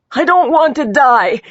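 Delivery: high, loud, frightened cry; desperate plea. A narrator's reading. Injured woman grabbing detective's wrist, desperate plea. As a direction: plead loudly, pitched well up.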